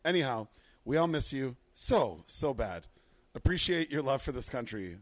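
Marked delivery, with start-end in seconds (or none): none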